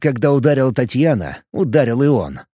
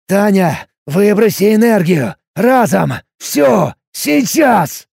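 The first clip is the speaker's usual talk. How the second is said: higher, louder strained yell; hoarse shout; 7 semitones higher, 5 dB louder, slower than usual. A narrator's reading. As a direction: rasp out the orders in pain. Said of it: "прохрипел я". (хриплый крик от боли)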